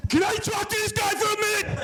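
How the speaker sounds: high, strained shouted demand; confrontational yell. Retold or imitated. Angry passenger demands to talk to a man, yelling. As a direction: call out to demand a word.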